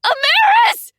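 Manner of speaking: very high, loud scream of a name. A narrator's reading. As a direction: scream her name in terror. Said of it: "It only screamed".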